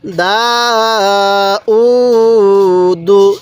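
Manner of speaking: দা and উ held long, দু short; one-alif elongation. Shown as a demonstration. Teacher showing how to read it, drawn-out দা উ, short দু. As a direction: stretch দা, stretch উ, then close briefly on দু. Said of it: "কীভাবে পড়তে হবে?".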